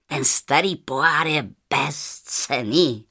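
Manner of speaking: low, contemptuous merchant; low scorn, leaning hard on words; scornful. A narrator's reading. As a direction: dismiss it with lofty disdain. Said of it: "řekl pohrdlivě".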